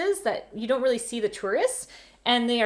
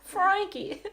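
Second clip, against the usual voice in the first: high, light New York echo of the name Frankie; a quick laughing New York "Frankie"; New York accent; 10 semitones higher than usual. Retold or imitated. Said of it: "you'd say it like this".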